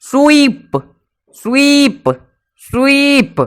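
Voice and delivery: स्वीप with a long ee, pitched high; teacher modelling स्वीप's long ee. Shown as a demonstration. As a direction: stretch the ee of स्वीप. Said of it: "double ई".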